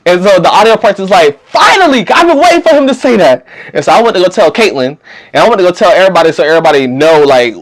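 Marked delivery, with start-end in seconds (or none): 1.5-3.4 high-pitched, emphatic outburst; mock exasperated relief. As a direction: exclaim it as if long overdue. Acting out an exclamation of relief.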